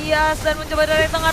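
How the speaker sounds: ordinary talk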